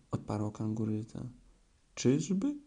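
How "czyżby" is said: sharp surprised question, much higher; a startled little "really?". A narrator's reading. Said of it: "zdziwił się Puchatek".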